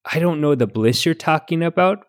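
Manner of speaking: Midwestern mom giving folksy advice; Midwestern mom accent. Acted. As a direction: answer like an understanding Midwestern mom. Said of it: "answer as a Midwestern mom".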